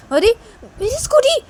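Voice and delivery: very high, loud cry of dismay; shocked cry. Acted. New girl cries out seeing her scooter knocked down, high shocked cry. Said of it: "वो लड़की बोलती कि".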